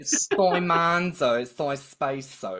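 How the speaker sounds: hammed-up Australian accent, slow and loud; thick Australian accent. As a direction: play up the Australian accent on purpose. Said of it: "Australian accent got thicker".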